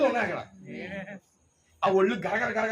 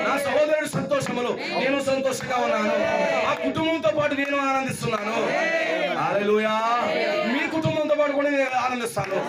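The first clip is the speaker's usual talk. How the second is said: raised, exultant preacher's voice; full-force preaching; 5 semitones higher, 3 dB louder than usual. Real, not acted. Preacher rejoicing with a family at their gathering, exultant preaching. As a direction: proclaim joy to the gathered church.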